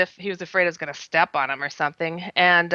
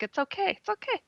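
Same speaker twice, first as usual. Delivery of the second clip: soothing; 3 semitones higher, faster than usual. Retold or imitated. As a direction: coo to a scared little one, hushed and high. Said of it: "to calm him down".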